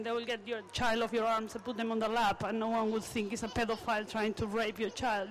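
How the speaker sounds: ordinary talk, nothing marked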